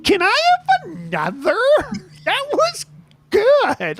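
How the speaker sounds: very high mimicked voice; high, halting put-on voice; tipsy woman impression. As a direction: squeak it out in broken bursts, imitating her. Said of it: "she was talking a bit like this".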